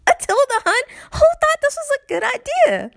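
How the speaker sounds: very high-pitched incredulous complaint; exasperated outrage. Real, not acted. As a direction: squeal it in outraged disbelief. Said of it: "I'm mad at a whole string".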